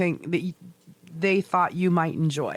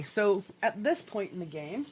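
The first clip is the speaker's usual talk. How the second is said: ordinary talk, nothing marked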